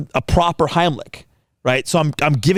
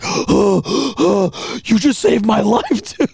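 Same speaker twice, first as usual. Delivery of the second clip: high, breathless mock gratitude; imitated gasping relief; 6 semitones higher, slower than usual. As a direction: gasp it out like someone just rescued. Voices friend gasping after choking.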